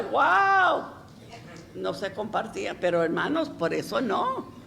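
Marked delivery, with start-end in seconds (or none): 0.0-0.8 high, loud awestruck wow; mock amazed exclamation. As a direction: gasp it out in wonder. Husband's amazed reaction retold.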